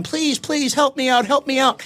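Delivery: lightly voiced high desperate plea; desperate pleading. Stuck man yelling to anyone passing, mild high pleading. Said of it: "He's yelling and carrying on".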